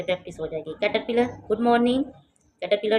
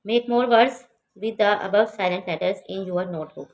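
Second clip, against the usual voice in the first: slow careful reading; 4 dB louder, much slower than usual. Shown as a demonstration. Teacher reads English instruction.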